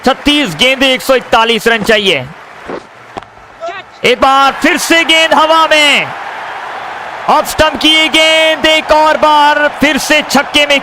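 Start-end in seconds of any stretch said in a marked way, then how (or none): 4.0-10.8 higher, shouted six call; excited cricket commentary. Real, not acted. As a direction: call the six with a big lift.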